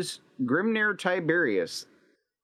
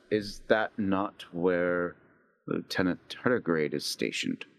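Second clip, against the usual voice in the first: low chaplain question; 8 semitones lower, 3 dB quieter than usual. Acted. Chaplain perks up.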